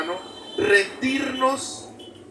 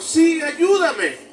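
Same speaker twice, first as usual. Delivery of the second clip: pleading; 8 semitones higher, 5 dB louder, much slower than usual. Acted. Climber begs God.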